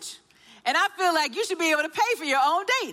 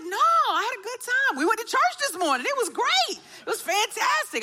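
mock innocent son; 7 semitones higher than usual.

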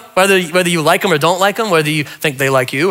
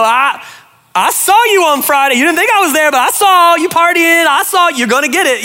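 mock scolding God; 9 semitones higher than usual. Pastor voicing a God who caught you partying, hammed-up. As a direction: catch them out like a gleeful busybody. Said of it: "God's like the referee".

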